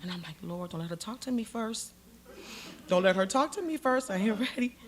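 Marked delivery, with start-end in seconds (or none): 0.4-1.9 muted plea. Retold or imitated. Silent plea while gathering herself. 2.9-4.8 raised, more insistent begging; urgent plea. Retold or imitated. Begging the Lord before Clinton enters, urgent plea.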